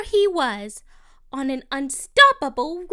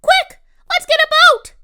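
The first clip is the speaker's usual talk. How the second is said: high, loud call to a friend; rallying shout for a rescue; urgent yell; 12 semitones higher, 11 dB louder, faster than usual. A narrator's reading. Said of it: "So I yelled to my friend".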